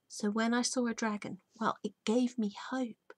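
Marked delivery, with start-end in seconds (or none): none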